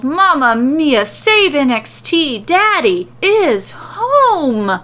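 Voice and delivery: hammed-up Italian-flavoured exclamation; excited cheer. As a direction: hype it up like a ringside fan. Shopkeeper cheers entrance.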